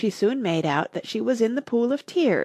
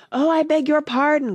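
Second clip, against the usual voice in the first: quick high flustered apology; hasty apology; 9 semitones higher, 5 dB louder than usual. A narrator's reading. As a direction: blurt it out in a rush. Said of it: "cried Alice hastily".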